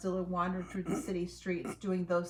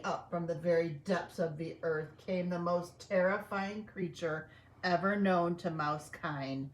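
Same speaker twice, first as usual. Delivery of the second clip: plain reading voice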